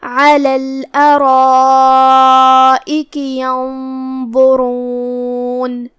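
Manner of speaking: very slow, long held notes in tajweed chant; teacher's slow melodic recitation; Quran recitation. Shown as a demonstration. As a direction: intone the verse, stretching every vowel. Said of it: "آخر میں ہم نون پہ رکیں گے".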